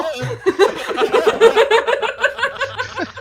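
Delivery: long, high peal of laughter; real helpless laughing fit. Real, not acted. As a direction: keep cracking up. Group mocks the bad cooking.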